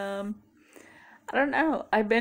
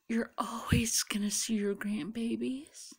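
reassuring whisper; 4 semitones higher than usual. Retold or imitated. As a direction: whisper it gently to reassure him. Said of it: "And I told him".

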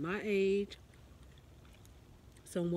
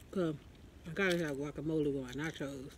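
chewing between words; talking while chewing. Real, not acted. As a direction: mouth partly full. Seen 0: bite taken at -2, lips close between words until about 2.9.

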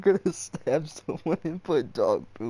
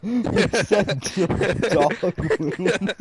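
laughing mock repeat; 4 semitones higher, 7 dB louder, much slower than usual. Real, not acted. Mocking friend's odd phrase, laughing.

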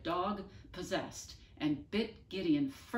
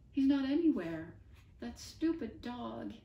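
sniffling; 7 semitones higher, 4 dB louder than usual. Acted. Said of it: "Eddie sniffed".

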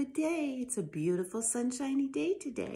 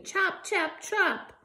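snappy chop; 7 semitones higher, 5 dB louder, much slower than usual. Acted. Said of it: "snapped the shingle splitter".

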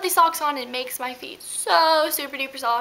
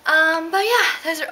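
out of breath; 4 semitones higher, 4 dB louder than usual. Real, not acted. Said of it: "I am so out of breath".

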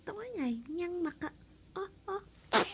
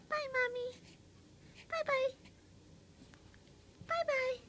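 put-on higher, light baby voice saying bye; 6 semitones higher than usual. Mother voicing her baby saying bye to Mommy, higher. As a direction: pretend to be the baby waving bye.

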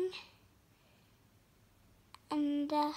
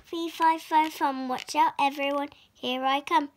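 louder giant roar, fast at the end; 6 dB louder, faster than usual. Acted. Giant roars as he comes, louder and faster. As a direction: boom it out like a giant. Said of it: "roared the giant".

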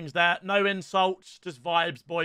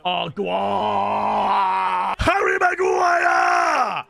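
ecstatic shout; 4 dB louder, much slower than usual. Real, not acted. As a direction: roar the scorer's name. Cheering his player scoring.

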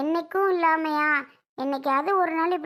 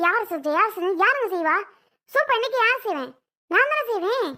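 snapping back; 4 semitones higher than usual. Acted. Wife snaps back at husband.

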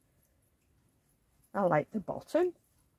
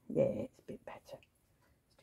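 whispered aside; 8 dB quieter than usual. Real, not acted. Fitting socks on doll.

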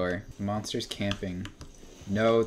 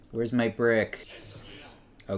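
normal talking voice

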